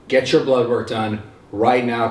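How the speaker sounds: ordinary talk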